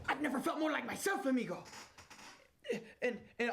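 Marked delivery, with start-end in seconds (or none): 0.1-1.6 put-on Mexican accent, pitched higher. Employee defies boss who sent him home, put-on Mexican accent. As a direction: slip back into the fake Mexican accent. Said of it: "doing a mid-Mexican accent".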